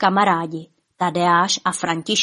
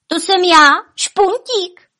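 high, loud, proud announcement; proud call; 11 semitones higher, 7 dB louder, slower than usual. A narrator's reading. Špuntík reveals himself to the guests, proud call. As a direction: call out your own name proudly. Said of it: "volal Špuntík pyšně".

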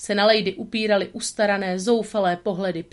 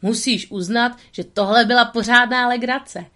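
blurted out; 4 semitones higher, 5 dB louder than usual. A narrator's reading.

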